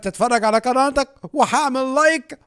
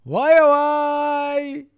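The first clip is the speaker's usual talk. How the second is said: stretched playful bye; 5 semitones higher, much slower than usual. Acted. Playful stretched goodbye to Sonic.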